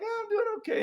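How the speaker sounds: high, thin, deflated answer; weary mock reply. March reply.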